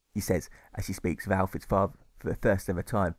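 ordinary delivery

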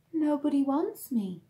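ordinary delivery

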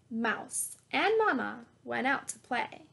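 ordinary delivery

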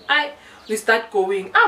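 normal talking voice

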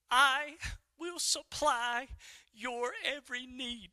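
slow, measured divine pronouncement; solemn promise, drawn out with pauses; God's answer. Retold or imitated. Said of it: "He said".

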